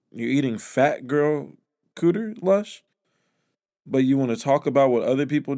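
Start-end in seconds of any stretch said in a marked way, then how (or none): 0.1-2.8 incredulous. Real, not acted. Disbelief at Lush's story.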